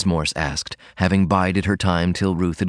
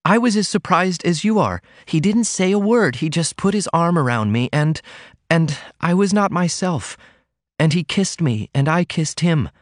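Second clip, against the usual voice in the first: put-on higher girlish voice, breathless confession; Ruth confessing; 10 semitones higher than usual. A narrator's reading. Daughter confessing a first kiss, high girlish voice. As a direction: let the memory spill out. Ruth's voice held.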